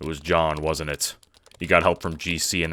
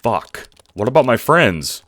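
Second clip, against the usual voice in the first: loud and higher, panic breaking through; alarmed cry; 5 semitones higher, 8 dB louder than usual. Acted. Dave to Aradia, after hearing he will die, a loud panicked cry. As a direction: blurt it out in fear for them.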